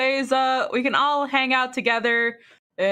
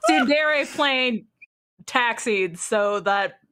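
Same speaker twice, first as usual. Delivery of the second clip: slow deliberate; 5 semitones lower, slower than usual. Acted. Repeating the joke line slowly.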